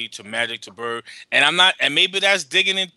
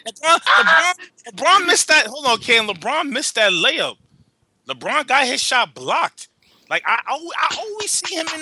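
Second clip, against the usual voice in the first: excited rant; 9 semitones higher than usual. Real, not acted. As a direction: fire up over LeBron's misses. Ranting about LeBron's misses.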